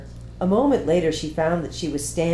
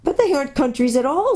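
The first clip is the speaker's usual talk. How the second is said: put-on girl character voice; 9 semitones higher, 4 dB louder than usual. A narrator's reading.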